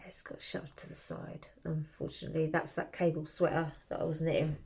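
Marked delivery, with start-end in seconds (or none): 0.1-2.5 rueful whispered aside. Real, not acted. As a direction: drop to a whisper, a bit guilty. Rueful aside about old project.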